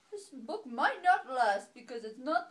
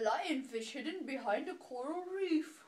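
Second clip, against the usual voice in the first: plain reading voice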